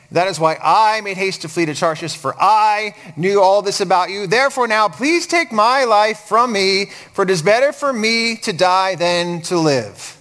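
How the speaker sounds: self-centred reading with "I", "me", "my" standing out; stress on I, me, my. Shown as a demonstration. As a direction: punch every "I", "me" and "my". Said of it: "he says, "I, me, or my".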